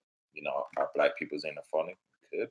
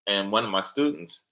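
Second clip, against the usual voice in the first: ordinary delivery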